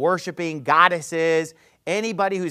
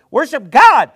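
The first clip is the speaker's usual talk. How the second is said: higher, louder, emphatic "Worship God"; loud emphatic repeat; 7 semitones higher, 14 dB louder than usual. Real, not acted. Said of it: "You know, worship God".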